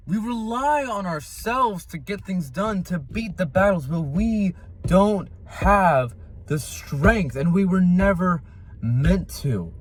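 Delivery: impassioned preaching. Real, not acted. Preaching to viewers that strength is God's gift, impassioned. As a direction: preach it with deliberate, punched phrases.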